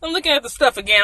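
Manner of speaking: ordinary talk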